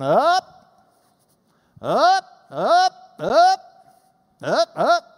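UP swept high and loud each time; a loud upward glide on every UP; repeated rising pitch. Shown as a demonstration. Teacher reads off accent marks, rising UPs. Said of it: "this accent meant you go up".

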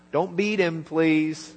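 normal talking voice

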